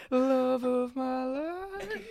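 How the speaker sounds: slow, high swooning line; mock romantic. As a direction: sigh it like a lovesick movie lead. Joking romantic Notebook bit.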